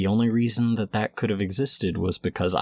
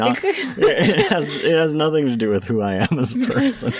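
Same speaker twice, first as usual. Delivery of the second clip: laughing; 9 semitones higher, 5 dB louder than usual. Real, not acted. He explains his vampire denial while laughing. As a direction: fight the giggles to finish the line.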